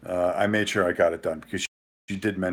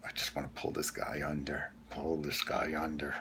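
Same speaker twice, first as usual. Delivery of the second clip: muttered frustration; 7 semitones lower, 13 dB quieter than usual. Retold or imitated. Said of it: "and I'm going".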